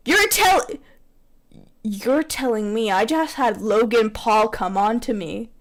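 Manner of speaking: usual speaking voice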